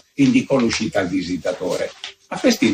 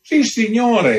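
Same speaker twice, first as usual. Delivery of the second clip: countess exclaims, much higher; proud exclamation; 12 semitones higher, 3 dB louder, slower than usual. A narrator's reading. Countess proudly exclaims to the visitor. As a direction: exclaim it with lofty pride. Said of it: "esclamò la contessa".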